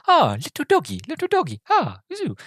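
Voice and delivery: very high, childlike cooing imitation; squeaky simpleton calling to a dog; [name]. Passenger cooing at the little dog, high put-on voice. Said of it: "you've got Rowan going".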